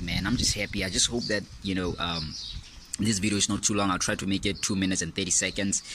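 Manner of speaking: ordinary talk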